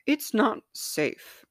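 high, slow insistence; insisting. A narrator's reading. Mind warns body, slow insistent voice. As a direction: mind insists it's unsafe. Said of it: "mind insisted".

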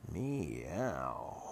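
quiet low meow, barely voiced; a soft, drawn-out cat meow. Cat stares at Barbara.

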